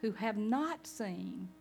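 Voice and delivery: very slow, soft, hushed blessing. Acted. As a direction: let each word settle gently. Jesus blesses believers.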